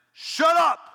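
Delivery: sudden high, loud yell; sharp, commanding shout; shouted rebuke. Acted. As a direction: blast it out to silence a demon. Jesus rebuking demon, loose translation.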